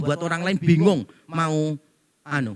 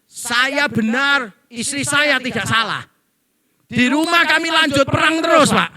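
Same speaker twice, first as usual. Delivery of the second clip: heated husband; 7 semitones higher, 7 dB louder than usual. Retold or imitated. Husband's heated answer quoted.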